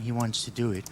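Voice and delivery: ordinary delivery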